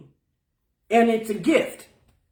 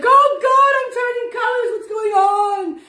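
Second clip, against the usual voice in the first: much higher, louder mock panic; panicking leaf; 12 semitones higher, 6 dB louder than usual. A leaf voiced in panic as it changes colour, mock alarmed. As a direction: squeal like a frightened leaf turning colours.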